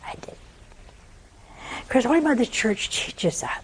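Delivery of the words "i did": whispered, barely voiced; hushed reply. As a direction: whisper it like a secret. God answering her, hushed.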